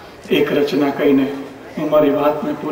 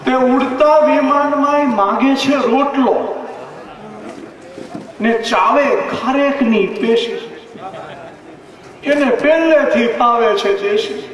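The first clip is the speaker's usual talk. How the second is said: lilting, half-sung comic verse, pitched up; satirical poem recital; 5 semitones higher than usual. Acted. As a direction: recite it like a mocking folk song to the crowd. (વ્યંગ્ય કાવ્યનું લયબદ્ધ પઠન)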